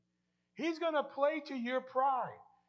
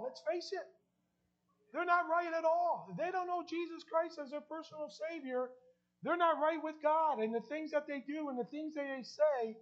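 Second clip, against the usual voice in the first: preaching; 4 semitones higher than usual. Acted. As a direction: drive the warning home from the pulpit. Preacher building to emphatic point.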